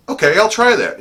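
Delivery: high, loud burst of agreement; eager agreement. Retold or imitated. Fellow herald agreeing to the closing plan, loud and eager.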